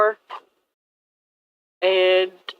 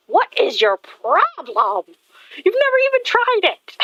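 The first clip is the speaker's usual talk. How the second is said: exasperated outburst; 11 semitones higher than usual. Retold or imitated. Illusionist exasperated at the hero refusing her food, rising high disbelief. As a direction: pitch shoots up in exasperation. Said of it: "And she's like".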